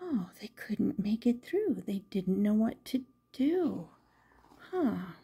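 soft quick whisper; whispered to the children. A narrator's reading. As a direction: hush it like a sad secret. Sad bunnies hopping away.

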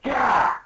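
high, loud mock yelp; anime-style cry of alarm; startled yelp. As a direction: burst out in acted shock.

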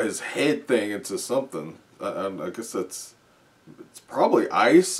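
ordinary delivery

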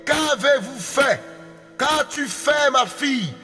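usual speaking voice

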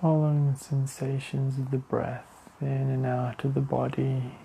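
slow, even meditation voice with long pauses; guided meditation. Real, not acted. Leading listeners to the breath, calm meditation voice. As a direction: guide attention inward, unhurried and steady.

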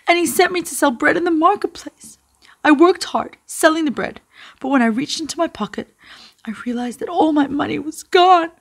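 high-pitched crying boy, acted; between sobs. A narrator's reading. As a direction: wail it like an upset child. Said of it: "he explained between sobs".

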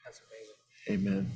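normal talking voice

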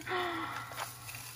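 high, soft gasp of delight; sharp intake of breath, delighted; amazed gasp. Real, not acted. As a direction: gasp at a lovely surprise. Gasp on seeing the next item.

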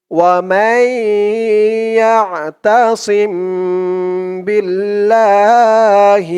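slow, drawn-out chanted recitation; Quran recitation. Shown as a demonstration. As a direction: recite it slowly, stretching each vowel. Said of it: "recites the verse".

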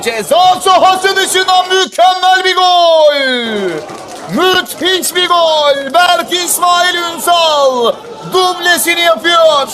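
screaming football commentator. Real, not acted. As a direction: roar the wonder goal slowly. Long-range goal, commentator erupts.

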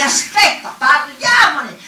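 loud, high, last word drawn out; urgent plea. Acted. Woman calling him back to negotiate, urgent and loud. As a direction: call out to stop him, stretching the end.

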